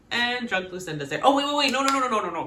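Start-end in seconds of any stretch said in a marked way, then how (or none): 1.2-2.4 loud, high, rapid-repeat alarm; hammed-up frantic halt; mock panic. Host spots drunk Lucinda interrupting the kiss, mock panicked.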